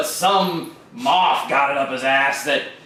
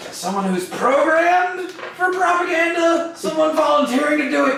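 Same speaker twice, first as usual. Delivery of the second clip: high-pitched glitch; 10 semitones higher than usual. Acted. Droid resumes after slogan outburst.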